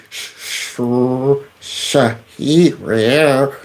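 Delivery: "Sh-" held, then "Shahir" syllable by syllable; laboured sounding out. Shown as a demonstration. Host attempting "Shahir", laboured sounding out. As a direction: creep up on "Shahir" from a hushed "Sh-". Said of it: "I'm going for it".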